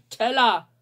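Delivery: bellowing order across the kitchen; furious chef. Acted. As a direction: roar the order, very loud. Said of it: "brüllt Sam".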